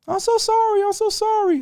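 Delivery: very high squeaky mock apology; mock worker apology. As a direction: squeak out a nervous sorry.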